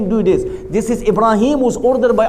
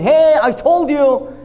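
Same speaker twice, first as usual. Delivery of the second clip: slow, higher, louder call-out; retold shout; 5 semitones higher, 4 dB louder, slower than usual. Ibrahim shouting at the priests, slow loud shout. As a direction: shout it at the crowd, drawn out. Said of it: "Ibrahim starts shouting".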